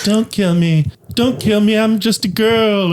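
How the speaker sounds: put-on high, breathy cutesy girl pleading; anime girl voice. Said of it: "voice changer that makes me sound like a hot anime girl".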